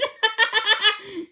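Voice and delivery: bright burst of laughter; helpless giggle; a laugh that escapes. A narrator's reading. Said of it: "She started to laugh".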